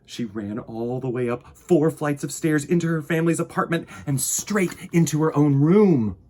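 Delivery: gentle storybook narration for little listeners; reading to children. A narrator's reading. Host reading Corduroy to young viewers, gentle storytelling. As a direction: read it like bedtime story time. Reading picture book aloud.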